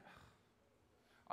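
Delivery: breathy exasperated ugh under the breath; whispered groan. Retold or imitated. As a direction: sigh it out, fed up. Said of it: "I'm like".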